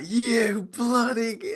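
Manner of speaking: high, drawn-out strangled cry; mock throttling fury, pitched right up; slow, straining mock outrage; retold outrage. Retold friend grabbing him in outrage.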